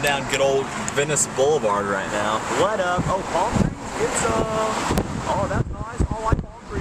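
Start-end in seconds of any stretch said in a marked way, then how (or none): 2.6-6.8 playful hype. Pretend. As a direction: cheer the palm trees like a fan. Hyped driving commentary.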